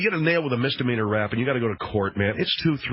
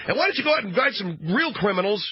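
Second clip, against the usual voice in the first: higher, mocking complainer; mock whiny rant; 9 semitones higher than usual. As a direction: mimic the indignant businessman, pitched up. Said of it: "this guy is talking about".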